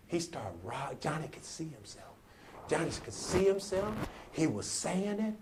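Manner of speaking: soft, intimate sermon storytelling; hushed preaching. Acted. Preacher tells of Johnny at the mirror, hushed. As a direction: draw the crowd in quietly.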